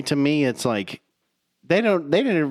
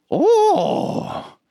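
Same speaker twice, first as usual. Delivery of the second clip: Yoda squeak; 4 dB louder than usual. Acted. Attempting Yoda voice.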